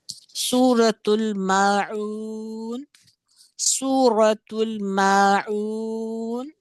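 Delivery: drawn-out Quranic chant; slow recitation. Shown as a demonstration. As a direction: recite the sura name slowly, stretched out. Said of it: "ये कौन सी सूरा है?".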